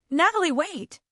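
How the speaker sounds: husband shouting after wife in the street, urgent; urgent call. A narrator's reading. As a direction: call out urgently to stop her. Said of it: "the urgency in his tone".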